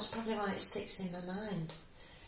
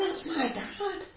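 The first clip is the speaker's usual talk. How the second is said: high-pitched delighted recognition; excited child; 9 semitones higher, much slower than usual. Retold or imitated. Daughter recalls seeing father arrive home on leave, high excited cry. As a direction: imitate a child calling out on spotting a parent.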